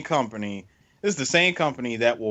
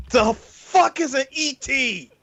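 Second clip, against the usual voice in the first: high, very slow mock coked-up question; high-on-coke marketing guy; 12 semitones higher, much slower than usual. A coked-up marketing guy questioning the film, slow and high. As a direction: drag it out, baffled and high. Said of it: "does a line of coke and goes".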